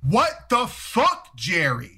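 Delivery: outraged onlookers call Jerry out; crowd's outrage. Retold or imitated. Neighbors catch Jerry peeing on a guy, slow outraged cry. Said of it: "everybody else is like".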